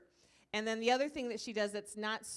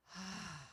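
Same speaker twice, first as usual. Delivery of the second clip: a soft, quiet letting-go sigh; long relieved sigh; 2 semitones lower, 8 dB quieter than usual. Retold or imitated. Imitating the boy's sigh of relief after his cry. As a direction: breathe out all the tension. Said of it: "he just decompressed and went".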